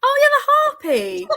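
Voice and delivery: high, loud laughing recall; gleeful shriek of recognition; delighted laugh. Real, not acted. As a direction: burst out laughing at the memory. Remembering the harpy.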